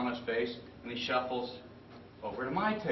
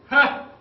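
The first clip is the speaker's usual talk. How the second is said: amused bark of a laugh; 14 semitones higher, 12 dB louder than usual. Retold or imitated. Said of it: "Same answer".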